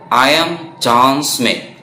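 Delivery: I am John Smith said very slowly; slow model sentence. Shown as a demonstration. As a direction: drag out each word so students can follow. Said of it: "saya bacakan".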